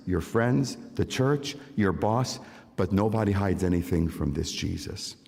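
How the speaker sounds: ordinary talk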